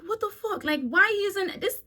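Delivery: high, incredulous what the; like, stranded tourist at a broken bus stop, incredulous; 1 exasperated disbelief. Retold or imitated. Traveller reading the closed stop sign, exasperated and high.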